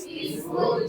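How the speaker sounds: whispered, barely a breath. Shown as a demonstration. Teacher showing the class what a peaceful tone sounds like, whispered. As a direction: breathe the word out gently.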